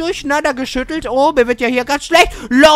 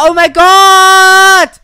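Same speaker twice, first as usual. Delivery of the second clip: drawn-out shout; 5 semitones higher, 9 dB louder, much slower than usual. Real, not acted. Sudden drop on the slide.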